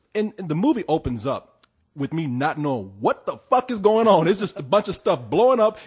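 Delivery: usual speaking voice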